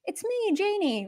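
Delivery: light, high-pitched call; lightly acted casual hello; everyday self-announcement. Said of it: "We now say".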